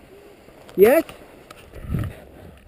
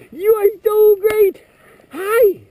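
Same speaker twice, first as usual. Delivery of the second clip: encouraging; 8 semitones higher, slower than usual. Real, not acted. Praising toddler on skis.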